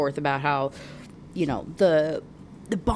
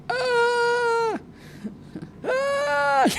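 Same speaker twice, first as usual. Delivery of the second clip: long, very high squeals. Real, not acted. Reader reacting to the mate confession, squealing. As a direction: squeal helplessly at the top of your range.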